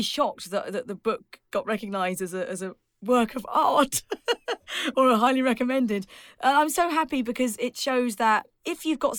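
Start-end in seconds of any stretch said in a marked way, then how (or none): 4.1-4.9 high self-amused laugh; real laugh. Real, not acted. Author joking about her recognised book, high giggle. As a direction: laugh at your own boast.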